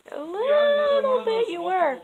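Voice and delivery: high, pointed sarcastic jab; sarcastic aside. Real, not acted. Answering his claim he wasn't mean, a high sarcastic jab. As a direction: poke back at him, high and dry.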